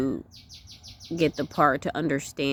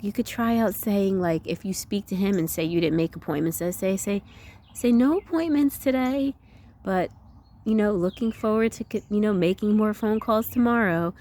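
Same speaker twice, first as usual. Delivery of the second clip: higher, upbeat suggestion; joyful; 5 semitones higher than usual. Retold or imitated. Homeschooling lady suggesting what to say, joyful. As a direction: imitate a friend's joyful, uplifted voice. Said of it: "she had such a joyful voice".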